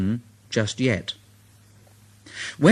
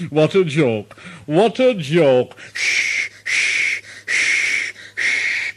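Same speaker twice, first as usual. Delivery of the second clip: Gordon chuckling; slower than usual. Acted. Gordon laughing and puffing off.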